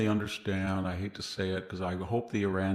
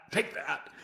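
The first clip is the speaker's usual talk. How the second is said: a little higher, taunt fading slightly; mock defiant taunt, repeated; 6 semitones higher, 3 dB quieter than usual. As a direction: repeat the jeer, a bit less punch. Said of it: "and he goes".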